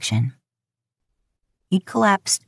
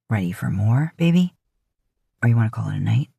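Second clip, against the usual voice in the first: low, easy man's voice; 6 semitones lower, faster than usual. A narrator's reading. Man asks his lover if she wants more, low voice. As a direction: ask it low and relaxed.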